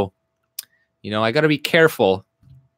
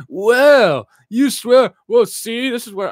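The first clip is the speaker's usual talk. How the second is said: high, loud whiny mimicry; mock indignant complainer; 11 semitones higher, 6 dB louder, slower than usual. Streamer mocking a commenter who replied, high indignant whine.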